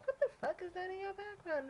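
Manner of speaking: high, mocking quote of the chat; a lightly hammed-up busybody; mock nosy chatter. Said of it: "I was like".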